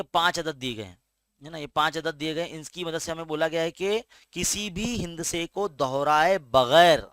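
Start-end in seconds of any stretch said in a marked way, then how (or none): 6.4-7.0 बगैर punched hard and loud; stress on without. Shown as a demonstration. Teacher to pupils, stressing the rule with a loud बगैर. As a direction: hit बगैर with a sudden loud push.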